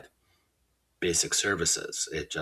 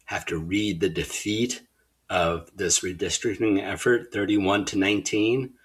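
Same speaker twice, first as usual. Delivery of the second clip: normal talking voice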